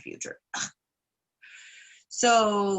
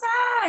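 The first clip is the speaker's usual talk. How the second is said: sudden high yelp of fright; startled yelp; 4 dB louder than usual. Retold or imitated. Trickster spotting a bear in the cave, startled high yelp. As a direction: jump at the bear's eyes with a sharp high cry.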